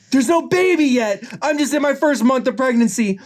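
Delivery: high, heated rebuttal; heated Judy. Acted. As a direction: argue back in a very high voice. Judy arguing back in quarrel.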